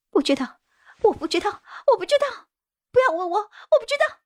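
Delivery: high-pitched cries escalating in panic; hysterical breakdown. A narrator's reading. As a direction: break down and refuse to be asked. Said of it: "王小红突然崩溃地大喊道". (崩溃地尖声喊叫)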